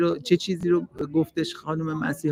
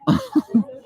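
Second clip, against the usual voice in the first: laugh; 13 semitones higher than usual. Real, not acted. Laughing at interviewee's joke.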